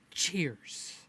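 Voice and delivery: breathy hushed whisper of cheers. Real, not acted. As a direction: drop it to a secret whisper.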